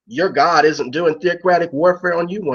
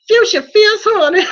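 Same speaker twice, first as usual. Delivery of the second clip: sassy drag queen; 13 semitones higher, 6 dB louder than usual. Acted. Announcing a drag name.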